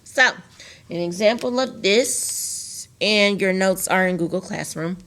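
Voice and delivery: ordinary talk, nothing marked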